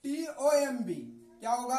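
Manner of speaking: ordinary talk, nothing marked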